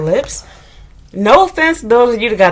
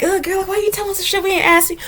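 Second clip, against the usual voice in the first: high, fast, scoffing viewer voice; mock viewer; 9 semitones higher, 5 dB quieter, faster than usual. Imitating viewers annoyed at her oversharing, high and scoffing. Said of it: "And I know you're like".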